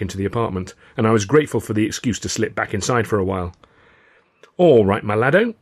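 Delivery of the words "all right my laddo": plain reading voice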